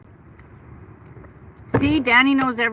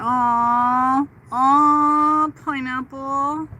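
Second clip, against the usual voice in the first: tender sympathy; 3 semitones higher, 5 dB louder, much slower than usual. Real, not acted. Sympathy for a lost son.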